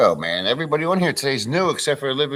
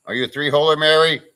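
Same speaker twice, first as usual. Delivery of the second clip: teasing; 5 semitones higher, 9 dB louder than usual. Real, not acted. Teasing a viewer by name.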